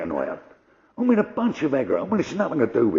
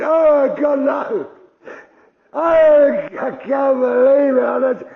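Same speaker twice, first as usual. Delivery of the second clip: high, loud, slow anguished cry; hammed-up despair; despairing wail; 9 semitones higher, 6 dB louder, much slower than usual. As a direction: howl in dismay. Man despairs over lights.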